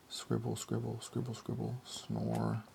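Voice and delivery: soft murmured scribbles then a snore; hushed sound words. A narrator's reading. Narrator to children, softly as Chris falls asleep. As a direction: hush it like he's drifting off. Chris working through night, sleeping.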